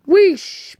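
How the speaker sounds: loud high wheesh whistle; ghostly whistle. Acted. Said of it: "Percy gave his ghostly whistle".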